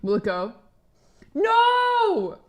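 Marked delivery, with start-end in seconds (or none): 1.3-2.4 high, drawn-out "no"; long high-pitched cry of protest; dismayed cry. Real, not acted. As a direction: wail it way up high, holding the vowel. Cries out at something failing.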